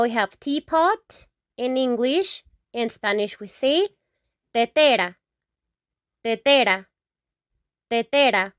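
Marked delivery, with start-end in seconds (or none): 4.5-8.5 "tetera" stressed on the middle syllable: te-TE-ra; stress on the second E. Shown as a demonstration. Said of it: "with an accent in the E".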